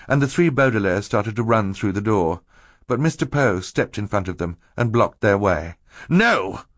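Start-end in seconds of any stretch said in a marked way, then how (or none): 6.1-6.6 loud high No; cry. A narrator's reading. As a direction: cry it out to stop them. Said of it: "he cried".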